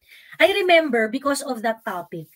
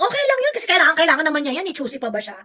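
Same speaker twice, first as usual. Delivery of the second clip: much higher, louder dismissive put-on voice; mock careless giver; 6 semitones higher, 5 dB louder, much faster than usual. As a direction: brush it off like a smug donor. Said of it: "Hindi yung parang pag nagbigay ka". (mapagmataas na boses ng nagbibigay)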